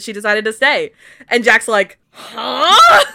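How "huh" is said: loud, high, startled huh; a baffled squawk of surprise; mock surprise. A friend plays Jack, startled to hear Kate stayed.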